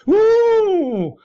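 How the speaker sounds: very high, loud camp whoop; shrieking high camp woo; John Inman woo. Retold or imitated. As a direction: whoop it like a camp sitcom shop assistant. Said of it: "can you picture John Inman going".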